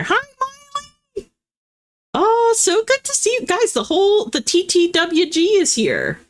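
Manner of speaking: light up greeting the regulars; excited greeting. Real, not acted. Streamer greeting her regular viewers, thrilled and high.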